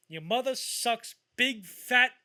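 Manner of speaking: slow, loud, high-pitched insult; mock-menacing drawn-out phone abuse; acted abusive taunt. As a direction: hurl each word slowly and loudly. Acting abusive phone call from film.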